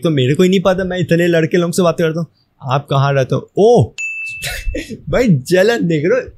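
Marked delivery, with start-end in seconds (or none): 4.0-5.1 breathy high-pitched giggle; high squealing laugh. Real, not acted. Bursts out laughing at chat.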